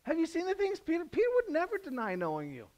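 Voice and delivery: very high, insistent Peter; Peter protesting. Retold or imitated. Peter insists to Jesus, very high and indignant. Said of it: "that's Peter".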